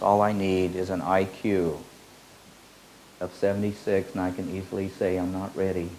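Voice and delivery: ordinary talk, nothing marked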